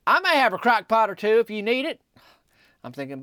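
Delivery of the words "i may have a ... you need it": called out loud from behind the counter, higher and louder; hollering. Retold or imitated. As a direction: holler it across the shop. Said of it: "Bud hollered from behind the counter".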